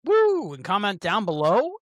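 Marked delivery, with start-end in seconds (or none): 0.0-0.5 high, loud whoop; excited cheer. Real, not acted. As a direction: cheer like a crowd. Cheering the donation link.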